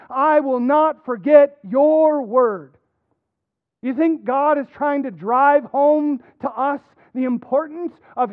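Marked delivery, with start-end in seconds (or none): 0.1-2.8 slow and louder, every word weighted; emphatic reading. Shown as a demonstration. Preacher closing the psalm reading with slow emphasis. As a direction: declare it firmly, word by word. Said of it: "I'm gonna emphasize it as I read".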